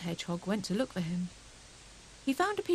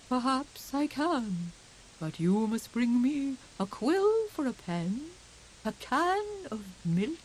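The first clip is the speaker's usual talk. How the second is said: slow, higher owl voice, rising loud; grand wise owl; 5 semitones higher, 3 dB louder, slower than usual. Acted. Wise Owl naming his price to Hedgehog, slow and grand. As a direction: bargain loftily, building to a cry.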